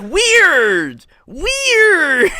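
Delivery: high, loud mock "Weird"; squeaky mock Ned. Mocking Ned's confusion with a high loud squeak.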